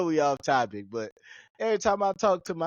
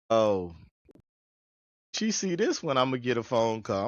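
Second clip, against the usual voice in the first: ordinary talk, nothing marked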